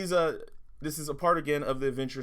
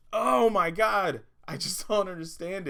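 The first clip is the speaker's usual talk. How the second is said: slow, loud, drawn-out disbelief; exasperated; 5 semitones higher, 5 dB louder than usual. Real, not acted. Venting at the toy maker, slow loud exasperation. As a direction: stretch it out like you've had it. Ranting about a lazy toy.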